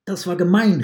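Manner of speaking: the wolf, betrayed, shouts at the catcher; angry outcry. Acted. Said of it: "rief der Wolf". (empörter, lauter Aufschrei)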